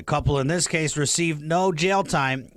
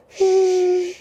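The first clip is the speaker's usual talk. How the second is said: put-on high falsetto cooing to a crying baby; shrill hammed-up baby soothing; bouncing a baby; 13 semitones higher, 5 dB louder, much slower than usual.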